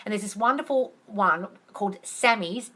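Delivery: normal talking voice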